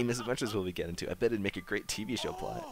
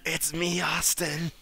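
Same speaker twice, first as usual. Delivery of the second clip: high loud spooky reveal; creepy reveal voice; 8 semitones higher, 5 dB louder, much slower than usual. Pretend. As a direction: announce yourself like a ghost. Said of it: "Okay, Golden Freddy".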